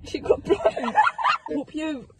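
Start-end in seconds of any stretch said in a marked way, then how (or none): 0.0-1.5 high burst of giggles; laughing. Real, not acted. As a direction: crack up mid-sentence. Friends giggling at joke.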